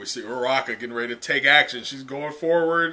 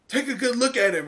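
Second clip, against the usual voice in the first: much higher urging call; Uraraka commanding; 8 semitones higher than usual. Acted. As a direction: make them look. Uraraka urges the crowd.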